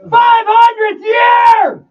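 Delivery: high, drawn-out full-force shout; band-name shout. Pretend. Band opens its radio station promo, loud drawn-out shout. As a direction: belt it out like a promo intro.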